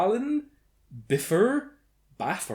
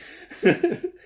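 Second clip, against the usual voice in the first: high laugh; 6 dB louder than usual. Real, not acted. Laughing at own joke.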